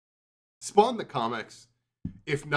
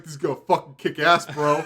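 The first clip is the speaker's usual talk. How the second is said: bro voice; 3 semitones higher, 7 dB louder than usual. Retold or imitated. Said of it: "Todd McFarlane was like".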